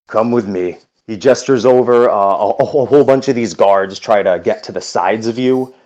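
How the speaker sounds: ordinary talk, nothing marked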